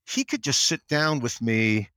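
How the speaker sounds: usual speaking voice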